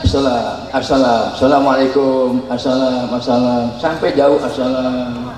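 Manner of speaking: a hint of mocking farewell calls; imitating greetings. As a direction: mimic waving off a departing traveller. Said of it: "Kalau mereka kita assalam".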